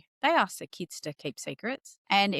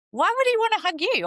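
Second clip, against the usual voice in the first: high, loud snarky comeback; sarcastic retort; 13 semitones higher, 5 dB louder than usual. Retold or imitated. To a rude relative, a high sarcastic comeback. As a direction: throw the line back with a high sneer. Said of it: "I'd be like".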